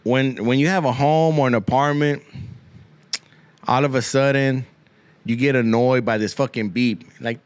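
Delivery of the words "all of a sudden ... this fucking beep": ordinary talk, nothing marked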